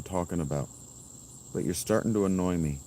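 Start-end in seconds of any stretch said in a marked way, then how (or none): none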